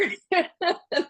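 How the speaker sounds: a real laugh pitched high; short disbelieving laugh. Real, not acted. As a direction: laugh at the absurd answer. Reacting to boss's answer.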